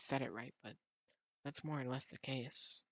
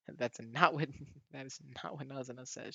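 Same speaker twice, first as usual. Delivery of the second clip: laughing; 6 dB louder than usual. Real, not acted. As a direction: crack up mid-sentence. Laughing at the game text.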